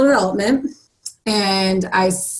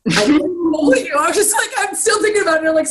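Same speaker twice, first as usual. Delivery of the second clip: higher, laughing through the words; 8 semitones higher, faster than usual. Real, not acted. Coach recalls being angry, laughing. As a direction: laugh it off while telling the story.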